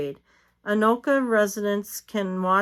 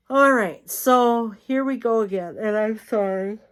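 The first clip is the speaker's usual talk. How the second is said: talking through a yawn; words stretched by a yawn; 3 semitones higher, 5 dB louder than usual. Real, not acted. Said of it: "I have to apologize for my yawning".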